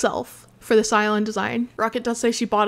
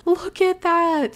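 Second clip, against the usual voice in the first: cooing; 7 semitones higher, 3 dB louder, slower than usual. Real, not acted. Cooing at cute item.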